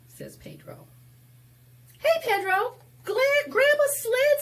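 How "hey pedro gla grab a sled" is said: very high and loud call. Acted. Henry calling Pedro to grab a sled, very high and loud. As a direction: shout it like an eager boy.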